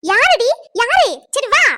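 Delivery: loud high demand; demanding shout. Acted. Mother demanding who scolded the girl, shouting. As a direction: shout the question.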